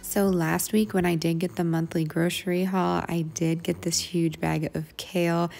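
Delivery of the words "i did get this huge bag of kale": ordinary talk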